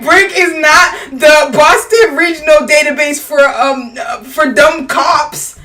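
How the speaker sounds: loud, slow, high mocking quote; mocking Frank. Mocking a councilman's speech to a friend, loud and slow. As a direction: ham up his rambling, slow and loud. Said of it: "he was like".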